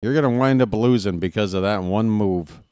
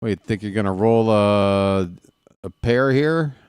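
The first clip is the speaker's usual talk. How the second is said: usual speaking voice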